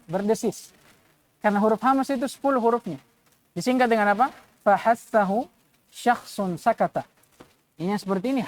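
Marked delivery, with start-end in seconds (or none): none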